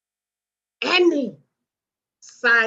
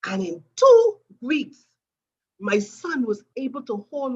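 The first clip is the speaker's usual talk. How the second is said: much higher, louder and slow; slow, high, loud build to the miracle; building excitement; 6 semitones higher, 5 dB louder than usual. Real, not acted. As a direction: announce the turning point with rising joy. Climax of the healing story.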